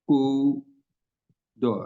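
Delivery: कूदो with a long stretched o; slow pronunciation demo; drawn-out kudo. Shown as a demonstration. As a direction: stretch कूदो slowly, the final o held. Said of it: "properly English में पढ़े ना".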